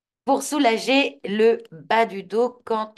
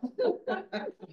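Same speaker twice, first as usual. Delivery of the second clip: high, light giggle; high-pitched amused laugh; 11 semitones higher, 5 dB quieter than usual. Real, not acted. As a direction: giggle at your own joke. Joking about toilet inspiration.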